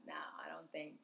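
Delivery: ordinary talk, nothing marked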